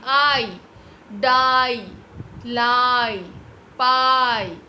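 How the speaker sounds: loud, drawn-out "aai" in आई, डाई, लाई, पाई; long I sound. Shown as a demonstration. Teacher correcting herself for the class, long loud आई, डाई, लाई, पाई. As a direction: stretch and punch the vowel in आई, डाई, लाई, पाई. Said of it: "का sound होता है".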